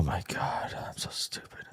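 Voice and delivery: whispered self-reproach, breathless. Real, not acted. Trader whispering at himself after blowing the trade, hushed. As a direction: hush it out to yourself in despair.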